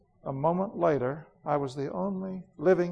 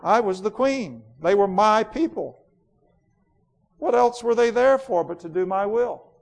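louder, scornful royal tirade; imperious queen Jadis, bitter; 5 semitones higher, 5 dB louder than usual. Acted. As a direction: rage coldly against the sister. Queen's lines.

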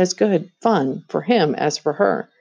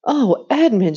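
cried out; 6 semitones higher, 3 dB louder than usual. A narrator's reading. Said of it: "she cried".

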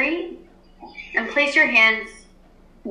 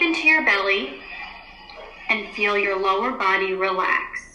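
guided meditation. Acted. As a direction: ease the class into stillness. Teacher leads class meditation.

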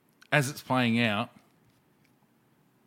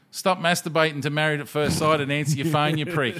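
mate's voicemail; 6 semitones higher, 5 dB louder than usual. Retold or imitated. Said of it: "And he goes".

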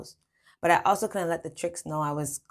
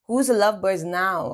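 louder playful jeer; joking; 3 semitones higher, 7 dB louder than usual. A narrator's reading. She turns French's lovebirds jibe back, joking. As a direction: throw the tease back triumphantly. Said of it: "I joked".

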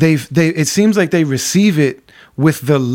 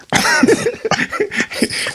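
airy laugh. Real, not acted. Laughing after the phone story.

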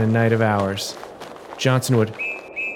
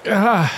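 high sharp groan as if jabbed; pained groan; 10 semitones higher than usual. Acted. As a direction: groan like he's been struck. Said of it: "let out a groan like he'd been jabbed".

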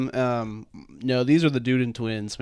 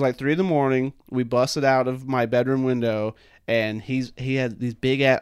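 ordinary talk, nothing marked

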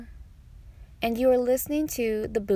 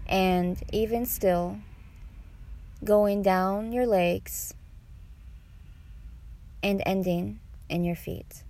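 guided meditation; 4 dB quieter than usual. Acted. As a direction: guide them unhurriedly through each breath.

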